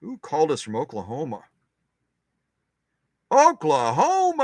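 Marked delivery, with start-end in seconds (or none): 3.3-4.4 drawn-out, much higher and louder cry of the state name; gleeful, stretched-out shout of the word; excited exclaim. Real, not acted. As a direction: sing it out with delight, slowly.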